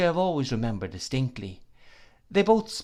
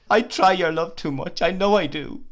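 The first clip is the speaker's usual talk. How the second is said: high, loud tearful confession; weeping, much higher and louder; sobbing; 7 semitones higher, 6 dB louder than usual. Acted. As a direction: sob it out to the man who loves her. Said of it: "she sobbed".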